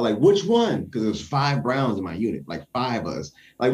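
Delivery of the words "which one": a mock shout to the first sergeant; shouted question. Soldier shouts from formation asking which Brown, loud and high. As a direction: call it out loud and higher across formation. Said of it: "I shouted out".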